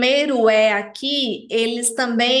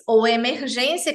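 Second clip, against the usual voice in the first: ordinary talk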